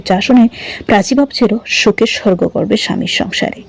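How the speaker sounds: plain reading voice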